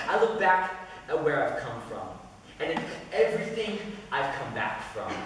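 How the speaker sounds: measured spoken-word poem, building; poem recital. Acted. Recites his own poem.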